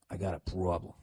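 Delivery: low, soft Boston "problem"; Boston accent. Shown as a demonstration. Host showing his returned accent, Boston accent. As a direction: lean on the Boston vowel in "problem". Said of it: "the Boston accent kinda came back".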